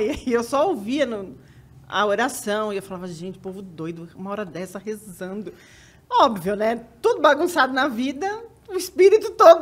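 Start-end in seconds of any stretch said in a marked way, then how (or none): 3.1-5.5 muttered grumble. Retold or imitated. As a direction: mutter it to yourself, half annoyed. Grumbling about praying roommates at night.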